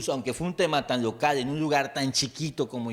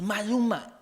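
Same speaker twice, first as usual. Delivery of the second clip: high mock crowd cry; crowd shout; 8 semitones higher, slower than usual. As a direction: shout it like the whole crowd caught on. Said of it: "Y entonces todos".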